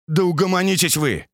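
sharp shout to calm down; snapping shout. A narrator's reading. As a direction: bark it out to silence them. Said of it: "прикрикнул Димка".

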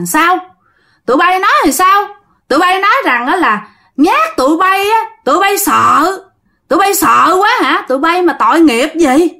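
high-pitched, scornful ghost challenge; accusing ghost. Acted. Thắm's ghost confronting praying villagers, high and scornful. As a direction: throw their pity back at them, high.

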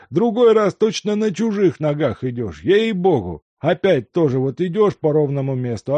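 put-on high, loud old man scolding himself; an old servant overjoyed and flustered; fussing old servant. A narrator's reading.

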